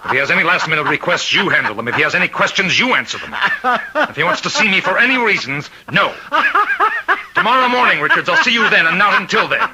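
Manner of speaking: possessed warden giving orders, manic and laughing. Acted. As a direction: order the guard about with unhinged glee.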